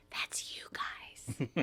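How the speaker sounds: unvoiced, slow whisper; mouthed. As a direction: mouth it to the gang, almost silent. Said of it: "she mouths to the gang".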